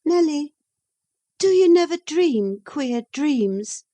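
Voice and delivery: put-on a young lady answering back, pitched high; Catherine. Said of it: "she said, suddenly".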